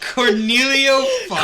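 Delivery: loud, high, laughing shout; helpless laughter. Real, not acted. Readers hit a ridiculous name, laughing hard. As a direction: crack up while crying out the name.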